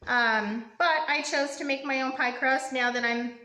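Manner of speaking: ordinary delivery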